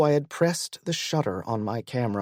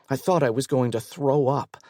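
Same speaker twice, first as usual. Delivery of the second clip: ordinary delivery, nothing marked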